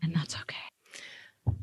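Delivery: slow, hushed whispered echo. Pretend. Co-host echoing the host's cheer, slow whisper. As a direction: whisper it slowly, barely any voice.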